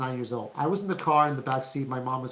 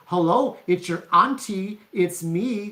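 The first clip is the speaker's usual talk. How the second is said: high, louder singsong auntie voice; imitated aunt's odd greeting; 4 semitones higher, 4 dB louder, slower than usual. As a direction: mimic an aunt announcing herself, stretching the words. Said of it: "why are you talking to me this way".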